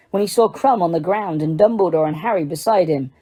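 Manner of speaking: ordinary delivery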